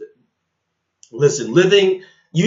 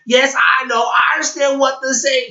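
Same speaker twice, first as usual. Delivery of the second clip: mocking imitation; 7 semitones higher than usual. Mimics the man's insistent claims.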